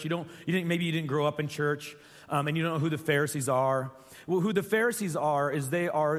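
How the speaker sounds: normal talking voice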